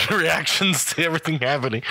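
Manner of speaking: ordinary delivery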